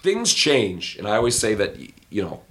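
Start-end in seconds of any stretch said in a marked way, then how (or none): none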